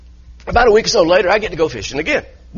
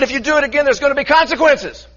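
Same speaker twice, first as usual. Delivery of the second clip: higher, raised-voice replay of a shouting match; retold angry yell; 6 semitones higher than usual. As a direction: relive yelling at the boater, a touch hammed-up. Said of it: "and we're yelling".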